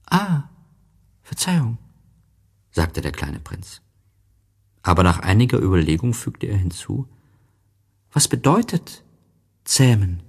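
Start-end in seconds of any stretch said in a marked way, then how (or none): 0.1-1.8 put-on high childlike story voice; voiced little prince, lifted well up. A narrator's reading. 8.1-10.2 put-on high childlike story voice; voiced little prince, lifted well up. A narrator's reading.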